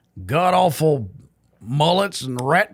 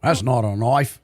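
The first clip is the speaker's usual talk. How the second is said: lower-pitched put-on Aussie accent; Australian accent; 5 semitones lower than usual. Shown as a demonstration. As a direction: do a movie-quote Australian accent. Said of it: "Can you do an Australian accent?".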